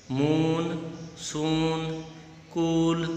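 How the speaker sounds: "मून, सून, कूल" drawn out on a steady oo; long oo. Shown as a demonstration. Teacher hints which word differs, oo held in "मून, सून, कूल". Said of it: "इसमें से किसका अलग है".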